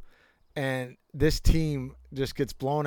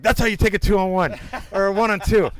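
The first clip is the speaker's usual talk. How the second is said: laughing coach call; 5 semitones higher, 5 dB louder than usual. Retold or imitated. Coach lecturing after a body check.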